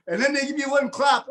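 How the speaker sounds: speaking through high laughter; laughing while talking. Shown as a demonstration. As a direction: keep laughing as you talk. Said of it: "now watch this".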